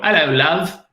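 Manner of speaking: imitating a five-year-old's British accent, pitched high; child's British accent. Dad imitating his son's contest entry, mock British accent. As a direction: mimic a little kid doing a British greeting. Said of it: "best British, uh, accent".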